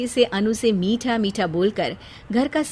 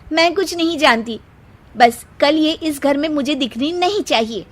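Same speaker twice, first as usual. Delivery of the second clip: high, louder ultimatum; nagging wife; 6 semitones higher, 4 dB louder than usual. Acted. Seema gives Ankit an ultimatum about his sister, high and shrill-toned. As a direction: threaten to leave, high and insistent.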